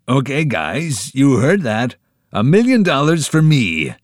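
loud, high showman's brag; hammy boast. A narrator's reading. Genius Kelly showing off to his friends, hammy. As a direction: ham it up for the crowd. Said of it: "made a dramatic stage bow".

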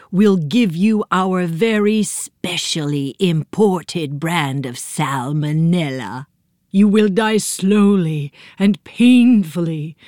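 drawn-out villain voice, relishing the threat; menacing yolk creatures. Acted. Egg yolk invaders gloat at Sybil Hunch, slow alien menace.

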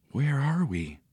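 hushed, soft question; whispered. A narrator's reading. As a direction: whisper it softly, unsure where you are. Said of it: "whispered Nancy".

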